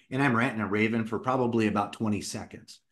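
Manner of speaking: ordinary delivery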